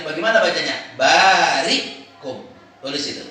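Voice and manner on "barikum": "Barikum" slow, long "baa" and stopped "ri"; hamzah sukun. Shown as a demonstration. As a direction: halt sharply after "ri" in "Barikum". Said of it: "Kalau sukun hamzah berarti". ("Barikum" pelan, hamzah mati setelah ri)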